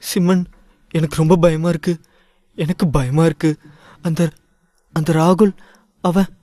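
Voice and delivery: faltering scared voice, stumbling over the name; frightened girl who can't get the words out; halting, fearful, breaking off. A narrator's reading. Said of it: "அவள் பயந்த தொனியில்".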